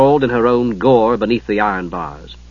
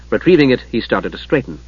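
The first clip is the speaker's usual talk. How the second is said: usual speaking voice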